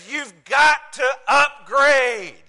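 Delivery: slow, high mock shout; exasperated son. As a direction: hammer each word at Dad, imitating a fed-up son. Retold son insisting on upgrade.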